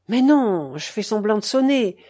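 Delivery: plain reading voice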